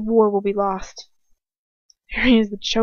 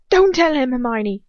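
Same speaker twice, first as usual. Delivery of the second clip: high, loud warning; shout; 4 semitones higher, 7 dB louder than usual. A narrator's reading. Ron to Hermione as Lucius questions her, shouting. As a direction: shout out, dropping on the name. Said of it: "Ron shouts".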